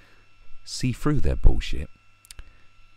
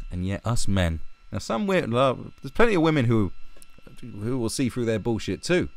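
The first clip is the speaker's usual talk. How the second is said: normal talking voice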